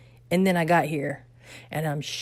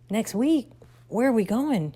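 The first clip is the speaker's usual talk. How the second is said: higher, incredulous questions to mom; alarmed questions; 6 semitones higher than usual. Retold or imitated. Daughter pressing mom about the move, alarmed and higher. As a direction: sound caught off guard and upset.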